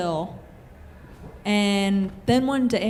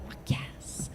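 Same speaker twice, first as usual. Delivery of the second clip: breathless whisper of victory; whispered triumph; 14 dB quieter than usual. Retold or imitated. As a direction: hiss it under your breath, thrilled. Private thrill at college news.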